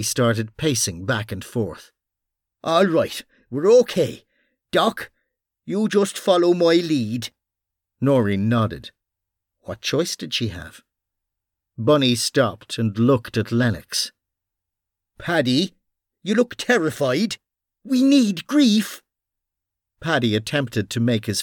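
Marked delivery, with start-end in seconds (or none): 2.6-7.3 tense, raised Irish man's voice; urgent gruff Irish cop; Irish detective voice. Acted. Bunny takes charge. 15.2-19.0 tense, raised Irish man's voice; urgent gruff Irish cop; Irish detective voice. Acted. Bunny coaching Paddy.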